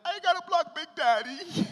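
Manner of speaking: high, loud put-on inner voice; inside voice. Telling the audience his scared inner thought, high and loud. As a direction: squeak out the secret thought. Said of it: "deep down in my inside voice".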